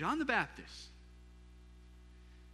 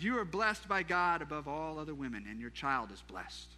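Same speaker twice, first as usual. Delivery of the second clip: normal talking voice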